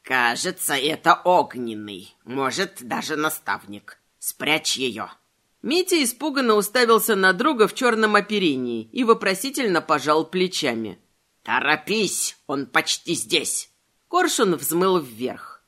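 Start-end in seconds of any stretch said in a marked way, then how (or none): 0.0-5.1 low, slow ominous croak; raspy bird voice. Acted. The bird warns of danger. 11.4-13.7 low, slow ominous croak; raspy bird voice. Acted. The bird urges hurry.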